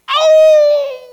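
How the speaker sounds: long, high, very loud "ow"; yelp of pain. Acted. Rabbi hit on the foot by table leg, pained yell. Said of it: "yelled Japheth".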